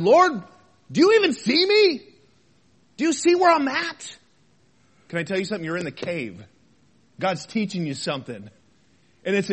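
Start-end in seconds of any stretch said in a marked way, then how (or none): 0.0-4.2 raised, louder pleading cry to God; honest plea to God. Acted. Worshipper getting honest with the Lord, pleading. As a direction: call up to the Lord, high and insistent. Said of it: "finally got honest with the Lord".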